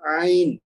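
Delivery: عین from the middle of the throat, a tight ع onset; throaty ع. Shown as a demonstration. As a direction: squeeze عین out of the mid throat. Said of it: "وہاں سے آواز نکلے گی حلق سے". (وسط حلق سے عین)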